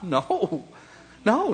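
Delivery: ordinary delivery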